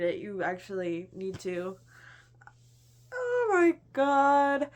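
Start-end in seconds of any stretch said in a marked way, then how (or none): 3.1-4.7 very slow, louder oh my god; drawn out in disbelief; a long exasperated gasp of awe; overwhelmed gush. Real, not acted. Gushing about the book.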